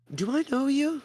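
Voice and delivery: the elf eyes the stranger, voiced high; elf's question. Elf asks suspiciously.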